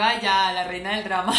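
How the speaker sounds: loud, playful mock exasperation; loud teasing jab that tips into laughter; teasing scolding. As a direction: tease them for being drama queens. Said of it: "ya a mis deportistas les digo".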